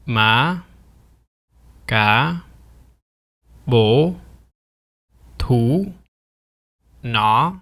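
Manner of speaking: Ma, Ca, Bo, Thu, Na each sliding upward; rising tone. Shown as a demonstration. Teacher giving example words, Ma to Na each rising. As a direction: lift Ma, Ca, Bo, Thu, Na one at a time. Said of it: "Here are some example words".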